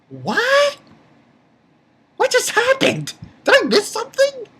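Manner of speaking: very high, baffled squeal; imitating his own shocked self; stunned disbelief. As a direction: shriek it in total confusion.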